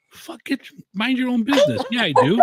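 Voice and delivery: high, laughing mock defiance; defiant outburst. Real, not acted. Defiant reaction to tip warning.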